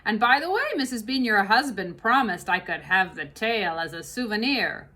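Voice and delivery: big kitchen woman grumbling about the fox; Mabel voice. Acted. Mabel asks for tail.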